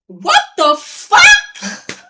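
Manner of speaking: high, loud and slow disbelief; re-enacted stunned yell; shocked outburst. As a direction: stretch it out in loud, appalled disbelief. Retold shocked reaction to officer.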